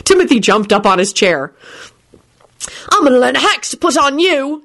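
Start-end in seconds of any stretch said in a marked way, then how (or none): 2.9-4.6 put-on higher, childish threat; boy threatening Lupin in play; mock threatening shout. A narrator's reading. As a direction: threaten him like a cocky kid. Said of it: "he shouted threateningly".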